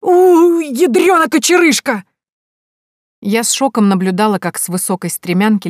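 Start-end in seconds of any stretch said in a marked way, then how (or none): 0.0-2.0 put-on old man's cry. A narrator's reading. Old man falls off ladder.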